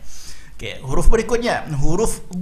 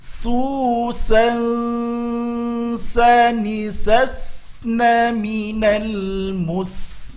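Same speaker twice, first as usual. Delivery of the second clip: slow Quran recitation drill; 6 semitones higher, much slower than usual. Shown as a demonstration. Teacher leading students in a slow drill. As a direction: chant each syllable slowly and clearly.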